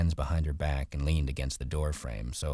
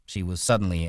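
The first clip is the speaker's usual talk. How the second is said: ordinary talk, nothing marked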